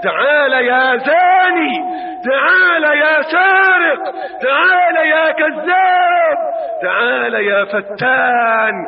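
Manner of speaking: stern divine summons. Acted. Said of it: "تلقاه يقول لك". (نداء صارم ممدود بصوت عال)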